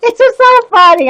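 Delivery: high, laughing through the words; can't hold the laugh in; cracking up. Real, not acted. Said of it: "I'm cracking up".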